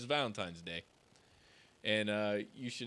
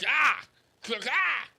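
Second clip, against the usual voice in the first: mock scream; 8 semitones higher, 5 dB louder than usual. Demon ripped in half.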